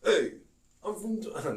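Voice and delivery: high mock Jersey bro voice, breaking off; hammed-up Jersey guy that cracks; mock Jersey accent attempt, cut short. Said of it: "now I can't do it".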